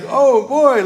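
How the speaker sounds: imitating the church folks' mild panic; worried "oh boy". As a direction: let "Oh boy" jump up high in mock alarm. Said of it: "like, they were worried".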